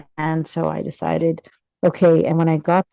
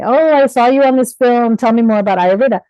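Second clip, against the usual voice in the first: imitating an eager fan, higher and louder, rushed at the end; 6 semitones higher, 6 dB louder than usual. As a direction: play a keen stranger asking for more. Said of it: "people started approaching me".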